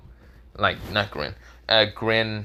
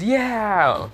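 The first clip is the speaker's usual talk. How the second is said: cheer; 10 semitones higher than usual. Acted. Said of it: "The boy says".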